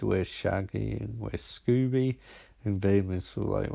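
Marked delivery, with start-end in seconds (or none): none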